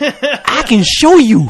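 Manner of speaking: high, slow mock ad voice; hammed-up kid pitchman; imitating Alfonso Rivera. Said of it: "Alfonso Rivera's ass comes on".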